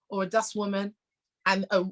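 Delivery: ordinary talk, nothing marked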